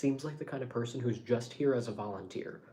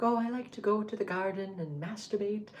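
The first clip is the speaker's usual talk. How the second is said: put-on high, cute little Norwegian-accented voice; dainty Norwegian lilt, lifted high; 9 semitones higher than usual. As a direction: imitate a sweet Norwegian accent, pitched well up. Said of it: "say it in her cute little accent".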